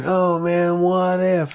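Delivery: loud, high stoner musing; dreamy drunk hippie wondering aloud. Retold or imitated. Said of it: "sound like a drunk hippie saying".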